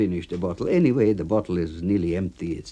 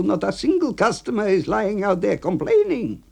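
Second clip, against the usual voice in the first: much higher voice, shrugging it off; dismissive A B; 11 semitones higher, 4 dB louder than usual. Acted. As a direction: brush off the complaint. AB lecturing.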